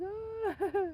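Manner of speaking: high, choked mock sob; a teary little cry of emotion; emotional sob. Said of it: "I was like".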